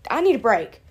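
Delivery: high, loud, whiny "how long do I have to persevere"; mock-plead with the universe for a break; exasperated complaint. Woman retelling her exasperation at the angel numbers, high and whiny. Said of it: "And I was like".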